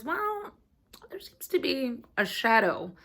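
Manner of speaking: high, drawn-out "well"; hesitant doctor. Retold or imitated. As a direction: imitate a doctor reluctantly breaking news. Voices doctor breaking bad news.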